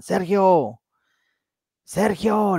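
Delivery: mock call from outside; retold calling out. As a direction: imitate someone calling through a window. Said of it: "en la ventana le gritaban".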